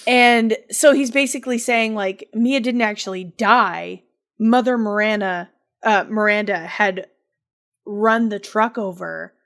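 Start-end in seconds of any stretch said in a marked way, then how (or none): none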